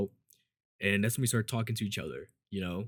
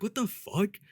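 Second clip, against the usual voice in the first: high-pitched mock shock; retold disbelief; 11 semitones higher, slower than usual. Student reacting to the director's odd demand, incredulous. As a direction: pitch way up, acting baffled.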